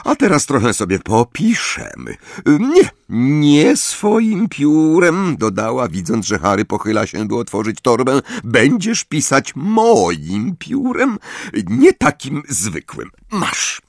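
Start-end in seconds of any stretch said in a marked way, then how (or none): 0.0-5.4 high, slow chirping sweetness; twittering. A narrator's reading. Umbridge voiced in narration. 8.4-13.8 high, slow chirping sweetness; twittering. A narrator's reading. Umbridge voiced in narration.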